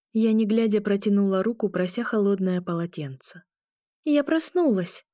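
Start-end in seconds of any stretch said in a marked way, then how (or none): 4.0-5.1 high, slow assurance; drawn-out high-pitched reassurance; assuring. A narrator's reading. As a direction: insist you're awake, pitch raised. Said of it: "заверила я".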